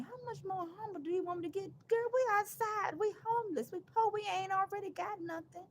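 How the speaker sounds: high, loud sarcastic rant; mock the idea of being humbler; loud sarcastic outburst at the viewers. Real, not acted. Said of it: "feel the sarcasticness to the screen".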